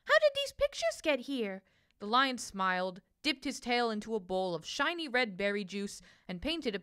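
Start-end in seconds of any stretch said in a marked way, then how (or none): 0.0-1.6 high, delighted little bird question; a high chirpy bird voice, full of delight. A narrator's reading. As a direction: ask with bright wonder.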